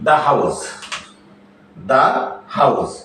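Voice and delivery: "the" pronounced da before "house". Shown as a demonstration. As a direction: model THE as da, with gaps between words. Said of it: "এর উচ্চারণ হবে দা".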